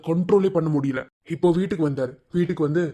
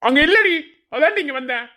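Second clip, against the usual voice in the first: high, raised angry voice; angry retort; 9 semitones higher than usual. Acted. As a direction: throw it back at her. Angry husband argues with wife. (கோபமான எதிர்வாதம்)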